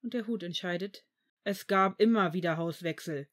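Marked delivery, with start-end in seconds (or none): 1.4-3.3 louder, irritated objection; angry interjection. A narrator's reading. Severus objects to Dumbledore about changing houses, angry. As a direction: cut in angrily. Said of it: "warf Severus wütend ein".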